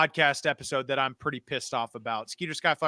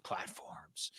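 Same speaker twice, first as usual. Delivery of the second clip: whisper; 14 dB quieter than usual. Acted. Ends the plug in a whisper.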